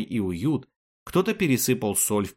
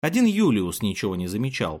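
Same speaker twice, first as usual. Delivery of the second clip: plain reading voice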